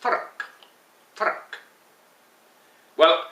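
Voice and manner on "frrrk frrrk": frrrk with a soft rolled Scottish R; frrrk, R trilled like kirk. Shown as a demonstration. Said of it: "Similar to the Scottish church".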